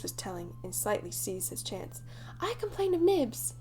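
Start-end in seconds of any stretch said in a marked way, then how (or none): 2.4-3.5 quick, high, louder complaint; eager tattling cry; cried promptly. A narrator's reading. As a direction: pounce on the chance to tell. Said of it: "he cried promptly".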